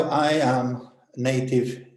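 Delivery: ordinary delivery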